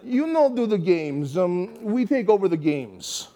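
a lightly mocking impression of a rival leader; imitating the other leader. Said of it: "and he goes".

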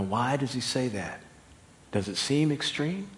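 ordinary talk

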